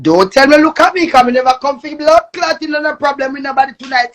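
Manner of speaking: usual speaking voice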